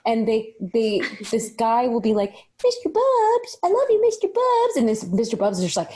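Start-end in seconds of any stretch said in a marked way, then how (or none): 2.6-4.8 put-on high cooing baby talk to a dog; high doting pet voice; doting owner. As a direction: coo at the dog like it's a baby. Guy cooing to his dog.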